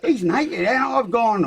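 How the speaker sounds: ordinary talk, nothing marked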